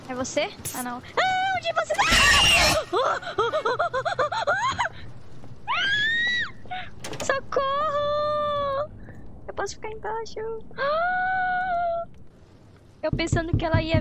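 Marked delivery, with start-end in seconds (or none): 1.0-2.0 high, panicked shout; scared shout. Real, not acted. Horror game jump scare. 2.1-7.2 high shrieking gasp; screaming gasp. Real, not acted. Monster appears in game. 7.2-8.9 slow, high wailing cry for help; terrified cry. Real, not acted. Monster chasing. 10.5-12.3 high gasping shriek; frightened gasp. Real, not acted. Scared in hiding.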